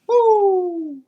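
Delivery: high, loud whoop of delight; excited whoop. Real, not acted. Metal caster whooping as the piece hits water, joyful cry. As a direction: let out a real cheer.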